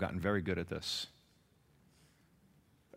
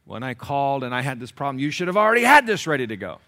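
building to a loud, high scolding; mock tirade at a service worker, rising; acted angry complaint; 4 semitones higher, 11 dB louder than usual. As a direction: start irritated and blow up by the end. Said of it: "when you're angry".

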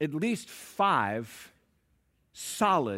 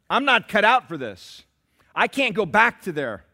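loud, stammering protest to God; Moses protesting; 8 semitones higher, 9 dB louder than usual. Acted. Moses pleading with God that he is not up to it. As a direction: stumble over excuses in full voice. Moses objecting, preacher acting him.

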